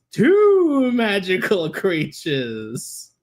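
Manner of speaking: put-on boy showing off his trophy; proud Connell reply. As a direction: draw it out proudly. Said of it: "And he says".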